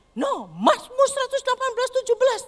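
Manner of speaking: high, loud defiant cry; defiant child. Retold or imitated. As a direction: fight back boldly. Child resists voice with verse. (anak melawan dengan suara tinggi dan lantang)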